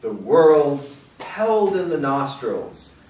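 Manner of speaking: slow, spacious poem recital; unhurried, weighted line endings; poetry reading. Acted. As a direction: linger on each image as you read to an audience. Reads a poem aloud.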